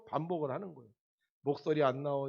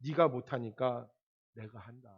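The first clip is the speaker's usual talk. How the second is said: calm God answering the singer, lower; God's voice; 7 semitones lower than usual. Retold or imitated. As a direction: say it as a gentle divine answer. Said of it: "하나님이 그러더라는 거예요".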